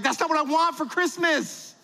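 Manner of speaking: high-pitched protesting wife; disappointed wife. Acted. Wife reacting to a handmade coupon book, mock dismay.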